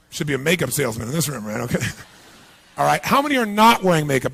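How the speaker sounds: normal talking voice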